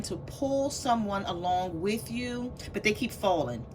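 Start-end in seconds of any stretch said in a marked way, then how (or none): none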